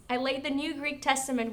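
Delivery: ordinary delivery, nothing marked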